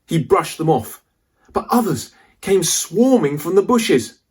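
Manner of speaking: ordinary delivery, nothing marked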